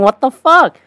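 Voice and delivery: milder replay of a shocked squeal; comic incredulity after a laugh; unspoken disbelief. Retold or imitated. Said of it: "Without saying".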